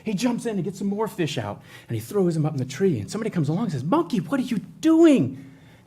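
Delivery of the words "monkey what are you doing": much higher, louder, slow puzzled question; puzzled passerby. Acted. A passerby in the fable asks the monkey: high, loud, slow, puzzled. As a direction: call out in disbelief at the monkey.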